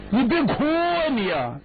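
high, flustered pleading to a crying sister; panicked older brother begging her to stop crying. Acted. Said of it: "李俊傻眼了".

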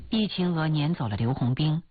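ordinary delivery, nothing marked

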